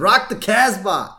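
put-on high, loud and slow kid quip; Bart's voice. Bart sending his mom off, slow high cheeky quip. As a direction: drawl it out like a cheeky boy. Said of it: "Bart says".

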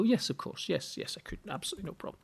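easy yes that trails off to nothing; soft agreeable reply. Retold or imitated. Husband agreeing, trailing off softly. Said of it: "they're most likely to agree and go".

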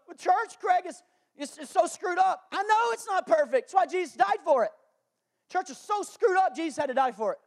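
hammed-up high whining complaint; mock objector. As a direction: whine that church is broken. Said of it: "This is how Jesus gives".

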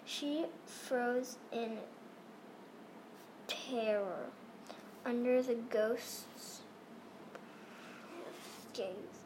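soft, hushed spooky narration; hushed suspense. Acted. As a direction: drop to a hushed whisper for suspense. Hushed climax of story.

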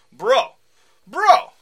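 loud, high yell of bro; shouted bro. Acted. Thugs calling out to Clint, loud high shout. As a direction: bark it across the room.

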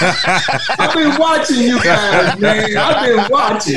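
talking through a laugh. Real, not acted.

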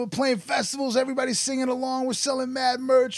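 high, fast and thrilled; excited. Retold or imitated. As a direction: gush about the big shows. Describing the European festivals.

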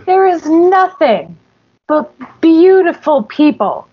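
hammed-up high declaration of agreement; emphatic acted agreement. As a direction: declare it grandly, pitched well up. Acting out emphatic agreement with Darius.

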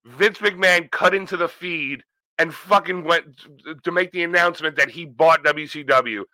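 normal talking voice

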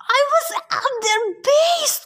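high-pitched shouted protest; angry yell. A narrator's reading. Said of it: "Thalia yelled".

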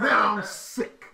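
slow, growled promo line; wrestling promo. Pretend. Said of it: "about to cut a promo".

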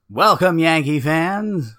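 put-on slow, high, loud old man on the PA; old man announcer. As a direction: drag it out like a ninety-five-year-old announcer. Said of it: "Why does he sound like a ninety-five-year-old man?".

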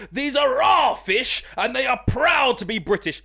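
slow, very posh, proud upper-class drawl; a lightly hammed-up Rees-Mogg, proud of the British fish; Jacob Rees-Mogg posh voice. Shown as a demonstration. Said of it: "Like, like Jacob Rees-Mogg".

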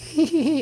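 high squeaky mock giggle of a rat. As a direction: giggle like a tiny evil rat. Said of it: "It starts laughing".

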